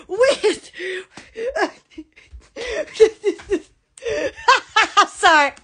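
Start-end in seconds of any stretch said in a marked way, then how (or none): 4.0-5.0 higher laugh bursting out; fit of giggles. Real, not acted. Host losing it mid product pitch, laughing hard. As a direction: lose it mid-sentence.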